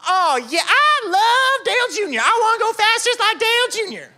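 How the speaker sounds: high, loud, breathless fan gush; excited kid. Retold or imitated. Little boy answering the brother about his hero, loud excited gush. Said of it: "That's how they all talk at nascar races".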